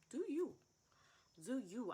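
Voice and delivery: emphatic shout. Real, not acted. Urging her viewers to live their own way, shouting. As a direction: throw it out as a blessing.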